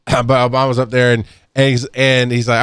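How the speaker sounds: usual speaking voice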